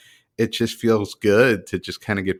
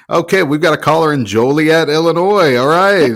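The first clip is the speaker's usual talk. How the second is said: put-on booming old-time radio announcer; radio host voice; 6 semitones higher, 7 dB louder than usual. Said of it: "I would do different voices".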